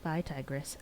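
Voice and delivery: plain reading voice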